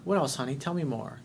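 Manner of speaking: soft, gentle fatherly prompting; gentle coaxing. Retold or imitated. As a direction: coax her to keep talking. Father gently drawing out daughter.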